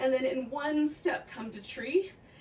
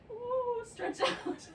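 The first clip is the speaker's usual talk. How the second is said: high squeal, breathless laughter; laughing squeal; 13 semitones higher, much slower than usual. Real, not acted. Wobbling in balance, squealing.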